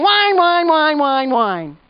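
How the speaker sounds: a drawn-out mock whine that fades down; mock whining. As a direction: mimic a nagging whine. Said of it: "All you're doing is going".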